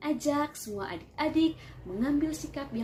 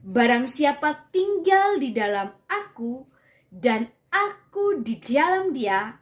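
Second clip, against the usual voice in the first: scripture reading; 6 dB louder than usual. Acted. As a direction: proclaim the verses to the room. Reads the Bible passage aloud.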